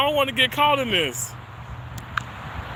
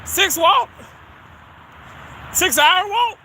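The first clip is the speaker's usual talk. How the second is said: higher, surprised questioning; puzzled disbelief; 8 semitones higher, slower than usual. Real, not acted. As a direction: echo it as an incredulous question. Queries the comment just read.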